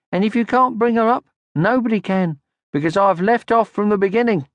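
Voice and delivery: put-on high girl's voice, self-important; Mimble's daughter. A narrator's reading.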